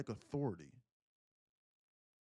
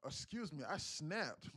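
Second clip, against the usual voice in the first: ordinary delivery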